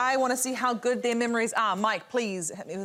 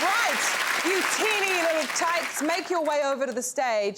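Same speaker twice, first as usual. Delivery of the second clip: high, rousing host announcement; rally the contestants, pitched up high; game show host; 4 semitones higher than usual. Real, not acted. Host sending teeny contestants to the final task, rousing announcement.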